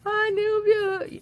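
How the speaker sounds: shrill pretend reproach to husband; mock scolding. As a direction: scold him in a squeaky put-on voice. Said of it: "I was just like".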